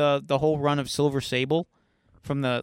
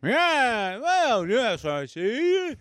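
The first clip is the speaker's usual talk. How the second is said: drawn-out, higher put-on voice; demonstrated character voice; 7 semitones higher, much slower than usual. Shown as a demonstration. Co-host coaches the reader, hammed-up demonstration. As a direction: stretch every word in a hammy voice. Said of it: "Put some bass into it".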